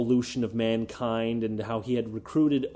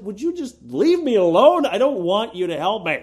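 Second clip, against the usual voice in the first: much higher and louder, fed up; exasperated outburst; 6 semitones higher, 7 dB louder than usual. Retold or imitated. Atheist snapping at preachy believers, loud exasperation. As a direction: snap back to be left alone. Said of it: "I'm just like".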